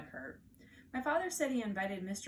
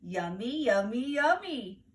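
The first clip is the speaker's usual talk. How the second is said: put-on louder sing-song baby voice; gleeful toddler; 6 dB louder, much slower than usual. Fudge tells mother the flowers were tasty, gleeful toddler voice. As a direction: savour it like a happy toddler. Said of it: "Fudge said".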